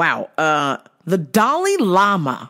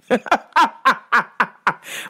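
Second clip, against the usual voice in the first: laughing; 4 semitones higher than usual. Real, not acted. Host cracking up at himself, laughing. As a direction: burst of laughter.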